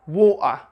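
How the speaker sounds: "water" with a catch in the throat for the T; Cockney-style glottal "water". Shown as a demonstration. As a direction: say "water" as "waw-uh", T swallowed. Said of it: "but others say water".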